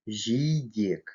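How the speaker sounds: "жидек" with a soft и, like ee; soft vowel. Shown as a demonstration. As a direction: soften the first vowel of "жидек". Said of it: "в мягких мягко". (мягкое «и» в слове «жидек»)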